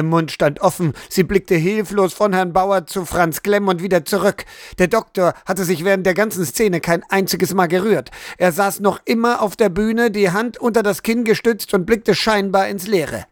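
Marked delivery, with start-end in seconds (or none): none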